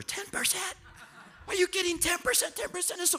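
very high mock squeal of disbelief; hammed-up falsetto outrage; shocked objector. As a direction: squeak it like a scandalized churchgoer. Acts out resistant listener's shock.

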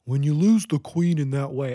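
lightly voiced goofy old man; goofy male voice. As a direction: imitate the narrator's silly man voice. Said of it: "she was talking like that".

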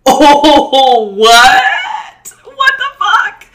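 high shout of disbelief, slowed; shocked shout. Retold or imitated. Student seeing police in principal's office: high shocked shout. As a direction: cry out in shock.

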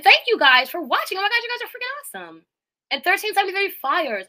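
high, fast gush of thanks; excited thanks. Real, not acted. Streamer gushing high and fast to generous viewers. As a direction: burst out thrilled at the chat.

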